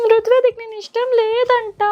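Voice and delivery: high, mock-weepy whine to win the toddler over; a hammed-up fake cry for the baby. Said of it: "నీలాగే నేను ఏడుస్తా".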